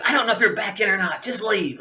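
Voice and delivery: high, quick, irritated dismissal; retold yelling. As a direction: imitate a fed-up boss without real volume. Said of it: "he yells at me".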